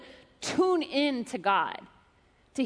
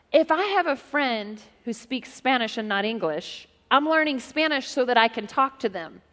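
usual speaking voice

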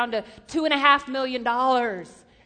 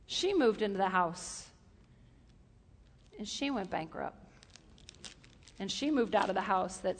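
softer and lower for the bad end; quiet letdown; 6 semitones lower, 7 dB quieter than usual. Preacher telling the friend's ruin, quiet and low. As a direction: drop the voice for the sad twist. Sad twist in story.

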